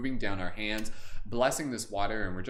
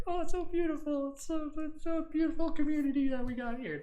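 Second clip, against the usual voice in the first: much higher, hammed-up voice of an overcome viewer; mock teary gushing. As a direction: gush like someone moved to tears, overdoing it. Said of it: "bonus points if you guys are already crying".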